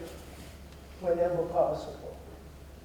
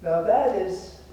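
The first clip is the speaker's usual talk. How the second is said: ordinary talk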